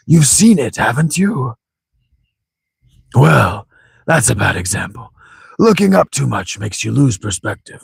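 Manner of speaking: put-on theatrical old sage with swooping pitch; hammed-up wise old man; lecturing old grandfather.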